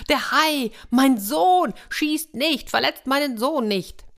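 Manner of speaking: high-pitched alarmed crowd shouting; frantic cries. A narrator's reading. Crowd and father see boy on shark, frantic cries. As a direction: cry out in alarm, voices tumbling over each other. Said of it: "schrien alle durcheinander".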